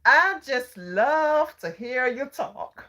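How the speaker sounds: slow, high Southern drawl; mock Southern accent, stretching the words; Southern gentleman. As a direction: imitate a Southern man, loud and drawn out. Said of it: "he was Southern".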